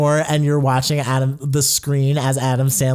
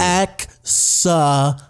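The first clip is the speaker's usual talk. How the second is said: very slow, drawn-out excellent; slow emphatic praise; 3 semitones higher, much slower than usual. Real, not acted. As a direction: stretch out the word excellent.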